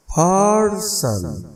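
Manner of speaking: drawn-out high third, unstressed सन; stress on first word. Shown as a demonstration. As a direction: stretch third, let सन fall away. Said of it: "उसकी pronunciation क्या है".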